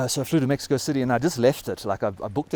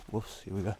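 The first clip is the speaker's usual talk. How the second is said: normal talking voice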